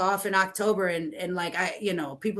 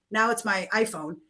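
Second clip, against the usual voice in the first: ordinary delivery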